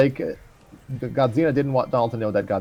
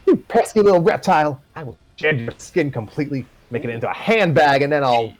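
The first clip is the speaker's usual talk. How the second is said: higher, louder furious villain threat; angry villain; 6 semitones higher, 4 dB louder than usual. Acted. Moore threatening the dragon in rage, higher angry voice. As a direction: snarl it at the little reptile. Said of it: "in anger".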